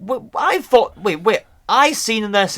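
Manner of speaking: stunned disbelief. Real, not acted. Realizes he was fooled.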